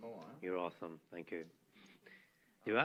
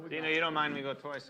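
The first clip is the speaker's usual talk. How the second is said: loud and quick; 5 semitones higher, 11 dB louder, faster than usual. Real, not acted. Loud fast proposal at table.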